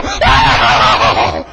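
loud, drawn-out revival scream; uninhibited holler. Acted. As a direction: cut loose with a wild "Ah!". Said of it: "all of us who just don't care, we just go".

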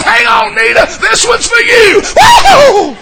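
high, loud exultant call; whooping dedication to Nita; joyful shout. Retold or imitated. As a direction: holler it at her with glee. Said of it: "I said".

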